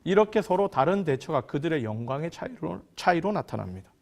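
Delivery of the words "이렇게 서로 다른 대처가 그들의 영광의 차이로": ordinary talk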